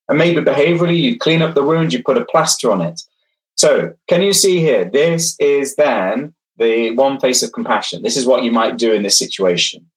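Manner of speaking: ordinary delivery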